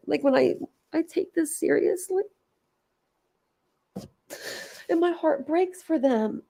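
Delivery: high, soft, tearful voice; real tearful heartbreak. Real, not acted. Streamer heartbroken for the kids on a live, tearful. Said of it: "I've bawled my eyes out".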